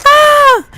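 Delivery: sudden very high startled scream. Pretend. Child screaming as a zombie jumps into the car. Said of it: "You're like".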